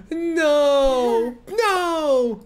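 high loud no; shocked cry. Real, not acted. Streamer yells no at the baby's scare, high and loud. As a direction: yell in alarm.

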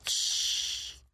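whispered shush; a quick whispered shh from cover; hushed shush. A narrator's reading. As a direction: breathe a warning hush. Hiding behind tree, hushing.

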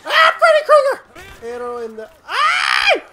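loud, high laughing yell; laughing shout. Real, not acted. Gamer laughing and shouting at a headshot. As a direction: howl with laughter as you shout it.

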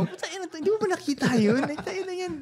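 high, heated cursing rant; angry rant. Retold or imitated. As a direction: blow up at the careless driver. Friend ranting about the pothole. (galit na pagmumura)

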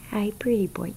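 whispered greeting to the kitten; hushed, voiceless coo; barely there whisper. Real, not acted.

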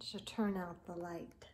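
soft unvoiced whisper; whispered bedtime line. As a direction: hush it as the light goes out. Bedtime lights out in story.

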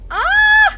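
loud high scream; startled scream. Real, not acted. Gamer startled by a game event, scream. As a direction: jump at something sudden.

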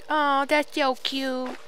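high, slow gushing imitation; mock cooing. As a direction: coo teasingly as your friend would. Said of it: "you'd probably like".

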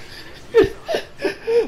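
high, airy, wheezing laughter; breathless wheezing laugh. Real, not acted. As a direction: laugh till it's almost a cry. Laughing at the stereotype.

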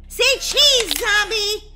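excited shout. Acted. Snapping a photo of zombie.

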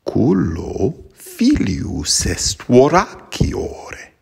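slow, measured reading of Latin verse; Latin poem recitation. Acted. Latin teacher reciting Catullus to students, slow and measured. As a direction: recite the poem slowly and deliberately.

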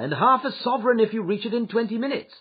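high, urgent call to a driver; called-out offer. A narrator's reading. Passenger promising the cabman money, calling out.

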